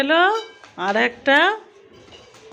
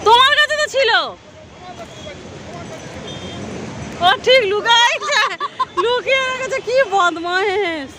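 teasing; 9 semitones higher than usual. Real, not acted. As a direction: tease the little one, voice high. Mother teasing toddler about hiding. (খুনসুটি করে বলা)

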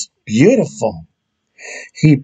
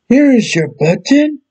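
higher friendly helper voice; Frog's voice; 11 semitones higher than usual. Acted. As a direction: offer it up brightly, a bit higher. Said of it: "said Frog".

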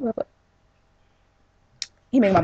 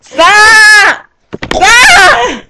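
high, loud wailing protest; protesting shout; 9 semitones higher, 8 dB louder, much slower than usual. Real, not acted. Sister protesting at horseplay, wailing shout. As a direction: cry out at the top of your voice.

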